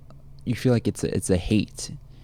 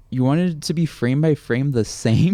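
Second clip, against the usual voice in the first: higher-pitched incredulous retort; mock incredulous; 6 semitones higher, 4 dB louder than usual. Host answers people mad about Lion King, mock incredulous. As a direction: put it as a disbelieving mock question. Mocking reply to remake complainers.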